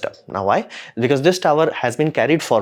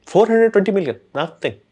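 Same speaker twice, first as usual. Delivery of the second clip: exasperated outburst; 8 semitones higher than usual. Real, not acted. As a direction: throw the number out in disbelief. Exasperated at wasted money.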